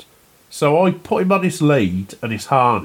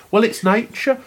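higher-pitched quote of mum; mum's protest, pitched up; retold mum; 7 semitones higher than usual. Son quoting his mum in an argument, higher-pitched retelling. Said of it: "my mum was like".

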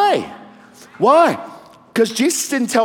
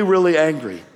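usual speaking voice

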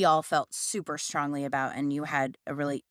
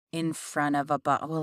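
usual speaking voice

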